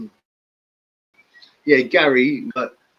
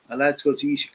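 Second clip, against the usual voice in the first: ordinary talk, nothing marked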